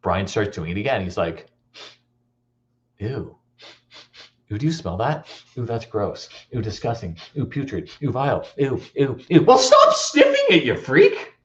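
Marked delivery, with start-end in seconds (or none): 3.0-9.4 soft, sniffing disgust in short bursts; playacted teasing revulsion; mock disgust. Older brother teasing with fake disgust. 9.4-11.3 very high squealed outburst; mock falsetto exasperation; exasperated kid brother. Younger brother snaps at the teasing.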